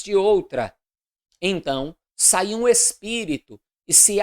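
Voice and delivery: loud, declamatory Bible reading; formal proclamation of an Old Testament narrative; solemn scripture reading. A narrator's reading. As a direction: read scripture aloud with measured, deliberate phrasing.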